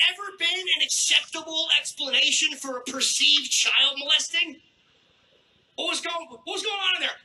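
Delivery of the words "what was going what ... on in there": high-pitched, playacted questioning; mock alarmed questioner. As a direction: ask it high, as a concerned onlooker. Acting out questioner accepting the excuse.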